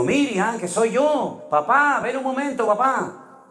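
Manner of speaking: high, insistent calling of papá; pleading call. Retold or imitated. Sick daughter not recognizing her father, calling him. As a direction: keep calling as if he weren't there.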